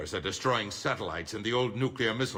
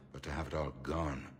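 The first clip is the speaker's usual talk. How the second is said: softly mourning, voice sinking; subdued grief; 4 semitones lower, 6 dB quieter than usual. Acted. Councilor mourning the lost Parliament to the commander, subdued grief. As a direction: trail into quiet, lower-pitched sorrow.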